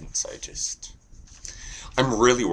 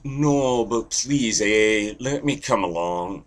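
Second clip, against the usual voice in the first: raised-voice little-brother begging; pleading Canada; 4 semitones higher, 4 dB louder, slower than usual. Acted. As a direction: plead to tag along, starting high. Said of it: "And Canada's like".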